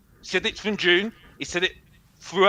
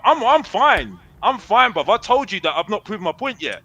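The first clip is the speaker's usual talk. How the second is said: usual speaking voice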